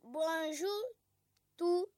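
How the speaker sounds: word by word reading. Shown as a demonstration. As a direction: read each word with pauses between. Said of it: "de lire après Moussa la première ligne".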